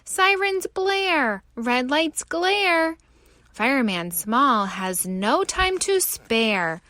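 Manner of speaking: plain reading voice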